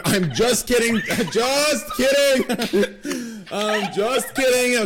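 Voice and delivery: high, drawn-out laughing shout; laughing retraction. Real, not acted. Host taking back his joke to the guest, laughing loudly. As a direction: stretch the words out through helpless laughter.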